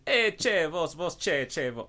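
high, loud opening on an exaggerated Argentinian Spanish cadence; mock Argentinian accent. Teasing a cohost who loves Argentinian Spanish, mocking Argentinian accent. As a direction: caricature Argentinian Spanish, starting high and sing-song. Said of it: "Ah, but the Argentinians".

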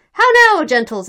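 high, loud opening hail, dropping after; loud announcement. A narrator's reading. Escorts presenting their man to the crowd, announced loudly. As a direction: hail the assembly. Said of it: "announced those who had brought them".

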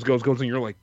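ordinary delivery

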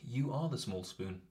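quiet and coy. Retold or imitated. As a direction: say it softly, teasing. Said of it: "And I was just like".

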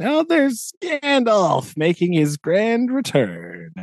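high, drawn-out singsong announcement; theatrical mock-triumphant reveal; grand fanfare. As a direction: herald a hero's entrance, stretching the words. Mock fanfare for Gandalf's return.

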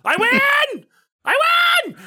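high, loud victory yell; excited shout. Retold or imitated. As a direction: shout it out like you just won. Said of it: "Daredevil screams".

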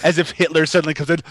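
ordinary talk

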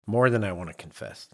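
normal talking voice

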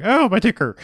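high-pitched mock cry of distress; hammed-up old-fashioned heart-attack exclamation. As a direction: play a comic cardiac complaint. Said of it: "when they're having a heart attack".

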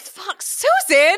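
high, slow exasperated outburst; exasperated cry. Real, not acted. Co-host curses Susan's slip, exasperated. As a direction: despair at the character's blunder.

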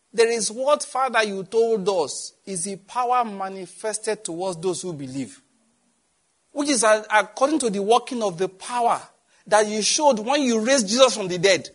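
preaching-prayer at full volume; fervent prayer. Acted. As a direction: cry out to the Father with full force. Bursts into loud prayer.

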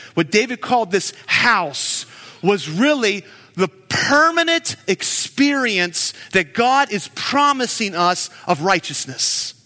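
ordinary delivery

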